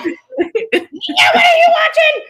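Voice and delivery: high, loud mock shout from a laughing mom; calling out her daughter, half laughing, from the mom's side; mom scolding. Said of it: "No wonder she was all like".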